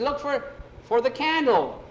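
high, loud order; urgent calling out. Acted. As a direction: shout directions across the dark. Friend calls across the dark.

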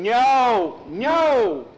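high, loud, drawn-out scream of no; yelling. Acted. Skeeter yelling in the cave, desperate yell. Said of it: "Skeeter was yelling".

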